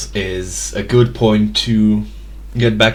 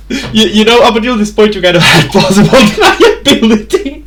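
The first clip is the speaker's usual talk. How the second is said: high, louder laughing talk; words squeezed out between real laughs; 13 semitones higher, 3 dB louder, faster than usual. Real, not acted. GM teases the player while cracking up.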